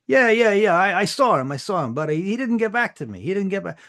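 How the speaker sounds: overeager, blurting it all out; Sparky excitedly telling the official about the interview; eager nervous Sparky. Acted. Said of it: "Sparky's like".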